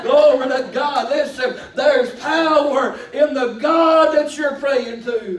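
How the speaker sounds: loud, drawn-out pulpit shout; impassioned sermon cry; full-force preaching. Real, not acted. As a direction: proclaim it with long, ringing stressed words.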